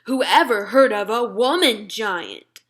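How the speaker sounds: loud, slow giant shout. Acted. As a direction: bellow it while swinging the vegetable. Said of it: "shouted the B F G".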